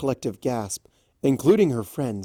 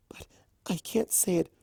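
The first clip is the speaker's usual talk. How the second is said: high, soft frightened voice; timid pony voice; 7 semitones higher, 6 dB quieter than usual. Acted. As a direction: plead quietly, scared. Fluttershy distressed, soft.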